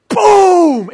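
loud high shouted splash boom. Pretend. Preacher shouting the cannonball splash for the congregation. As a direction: belt out the splash sound.

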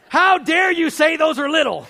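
high, loud outraged shout; mock anger. Angry fisherman scolding old man over trout, mock outraged shout. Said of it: "I'm mad. Remember, I'm angry".

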